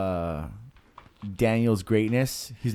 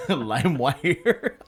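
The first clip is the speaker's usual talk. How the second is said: laughing; 5 semitones higher, much slower than usual. Real, not acted. Laughing at friend's joke.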